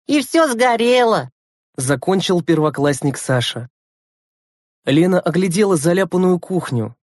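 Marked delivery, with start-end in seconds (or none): 0.1-1.3 put-on drawn-out, higher child's line; lightly voiced schoolboy. A narrator's reading. First-grader Sasha ends his account, childlike. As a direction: finish like a small boy telling a tale.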